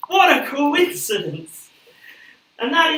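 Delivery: slow, high and loud; hammed-up amazement; mock amazement. Mock astonishment continues.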